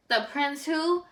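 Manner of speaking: ordinary delivery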